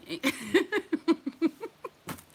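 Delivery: high laughing burst; real laughter. Real, not acted. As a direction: crack up laughing. Laughs at granddad bit.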